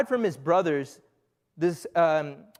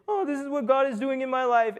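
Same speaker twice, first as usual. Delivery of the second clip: higher, gushing imitation of a boastful friend; gushing social post; 6 semitones higher than usual. Preacher mocks a friend's Instagram caption, in a high gushing voice. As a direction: mimic a friend showing off a blessing. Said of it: "they have a little description".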